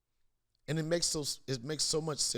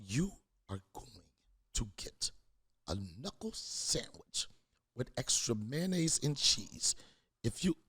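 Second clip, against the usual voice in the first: mock threat; 3 semitones lower, 3 dB quieter than usual. Dad mock-threatening his son.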